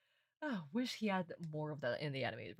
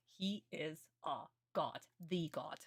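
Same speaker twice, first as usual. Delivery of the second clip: usual speaking voice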